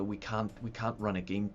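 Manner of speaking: ordinary delivery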